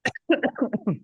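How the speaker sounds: sputtering cough on water; choking cough. Real, not acted. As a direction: cough as water goes down wrong. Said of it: "I'm choking on water".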